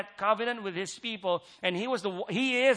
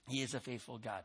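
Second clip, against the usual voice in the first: much softer and lower, almost under the breath; soft repeat; 6 semitones lower, 13 dB quieter, faster than usual. Real, not acted. Preacher echoing his own line to the church, hushed. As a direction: let it sink in quietly.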